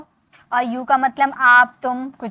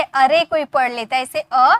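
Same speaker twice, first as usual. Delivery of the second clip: ordinary talk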